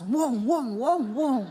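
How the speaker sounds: imitated engine revving; revving engine noise. Wife imitating the old car revving up the ramp, engine noise. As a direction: vroom of a car gunning it. Husband revs before the ramp.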